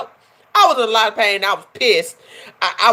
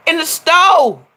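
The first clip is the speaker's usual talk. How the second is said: high, louder emphatic echo; excited repeat; 9 semitones higher, 4 dB louder, slower than usual. Real, not acted. Vlogger repeating it for her viewers, excited. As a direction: hype it up for the viewers.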